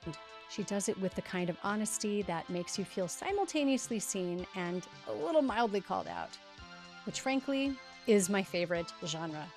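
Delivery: polished scripted host read; podcast intro read. Acted. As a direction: read the intro like a show opener. Scripted podcast intro over music.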